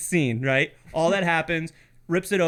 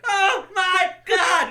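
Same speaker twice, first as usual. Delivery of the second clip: high, slow, loud wail of dismay; mock terrified; 13 semitones higher, 5 dB louder, much slower than usual. Friend voicing the scared camper, slow mock wail. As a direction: moan it in mock horror. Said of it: "And you're like".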